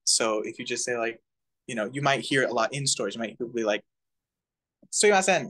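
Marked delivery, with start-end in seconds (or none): 4.9-5.5 Sumimasen run together fast, vowels dropped; high, loud, rapid shop-style call; really quick. Shown as a demonstration. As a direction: rattle it off in one quick burst. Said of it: "like, just really quickly".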